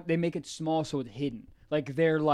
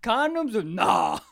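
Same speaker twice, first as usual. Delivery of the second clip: put-on Louisiana accent; 7 dB louder than usual. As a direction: drag it out in a thick Southern accent. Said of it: "I don't know what that accent was".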